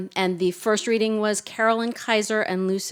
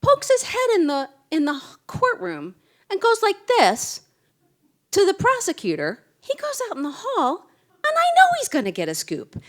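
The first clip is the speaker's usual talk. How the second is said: much higher, louder, breathless retelling of a frantic colleague; excited young reporter; 5 dB louder than usual. Retold or imitated. Speaker retelling a new reporter's rant about Mike Clark, high and loud. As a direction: squeal it out like a rookie who just got scooped. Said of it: "And she said".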